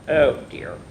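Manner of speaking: plain reading voice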